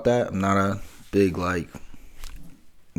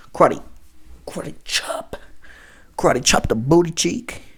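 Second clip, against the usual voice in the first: whispered aside; 7 semitones higher than usual. Pretend. Miming a booty slap.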